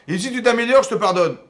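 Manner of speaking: high, booming declaration of pardon; loud proclamation. Acted. Teacher repeats God's promise to Cain, loud proclamation. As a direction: call it out loudly, far above normal pitch.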